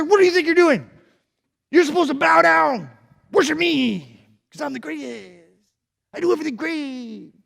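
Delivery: high, loud indignant rant; mad king. Acted. King scolding three men, high angry rant. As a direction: scold them like a spoiled tyrant. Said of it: "the king was really mad".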